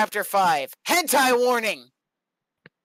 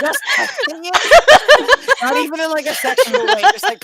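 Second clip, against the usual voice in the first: laughing disbelief; 13 semitones higher, 10 dB louder than usual. Real, not acted. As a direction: squeal it in disbelief.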